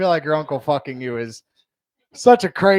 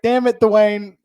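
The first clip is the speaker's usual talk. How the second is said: higher and louder, frustrated outburst; exasperated; 6 semitones higher, 7 dB louder, slower than usual. Retold or imitated. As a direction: blurt it, let down. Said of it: "And I was like".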